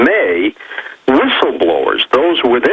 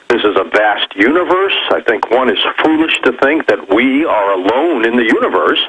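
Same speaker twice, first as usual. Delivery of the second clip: ordinary talk, nothing marked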